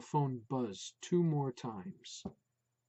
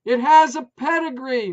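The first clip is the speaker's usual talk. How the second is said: high and loud; loud declaration; 10 semitones higher, 14 dB louder than usual. A narrator's reading. Wife declaring the dog's pedigree, loud. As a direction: proclaim it proudly. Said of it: "Maureen declared".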